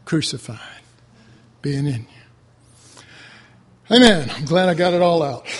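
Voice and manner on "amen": a loud, firm amen; emphatic amen. Real, not acted. Preacher closing his message with a loud amen. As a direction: land the sermon with force.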